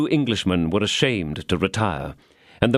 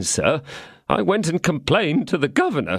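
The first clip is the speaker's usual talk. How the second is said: ordinary delivery, nothing marked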